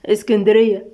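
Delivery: ordinary delivery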